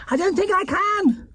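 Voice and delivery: high bear voice, upset; Paddington crying out. A narrator's reading. Paddington crying to the man as he speeds past. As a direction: cry out in a high little bear voice. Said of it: "cried Paddington".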